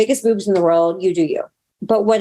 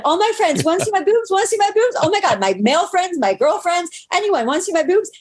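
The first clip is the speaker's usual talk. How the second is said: high, giddy offer to everyone; gushing with thrilled pride; excited squeal; 9 semitones higher than usual. Retold or imitated. To all her friends, excited high-pitched offer to look. Excited showing off new breasts.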